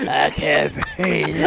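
very slow, strained scary rasp; mock Batman voice. Friend imitating his winded buddy, slow Batman rasp. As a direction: drag it out like a breathless Batman, hammed up. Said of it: "Why are you talking like that?".

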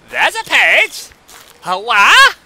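thrilled to spot it, stretch it out; excited. Real, not acted. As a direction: found it, yelling with glee. Spots the page in game.

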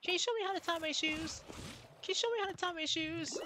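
high, fast squeaky pleading; cartoon voice. Gamer quoting a favorite episode, high cartoon voice. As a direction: chirp it quickly, way up high.

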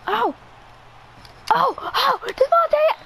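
high-pitched cry of the player's name; real burst of gamer excitement; excited shout. Real, not acted. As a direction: shout it high as the catch happens. Calling out a catch in game.

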